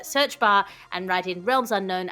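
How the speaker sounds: usual speaking voice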